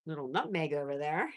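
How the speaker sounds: ordinary talk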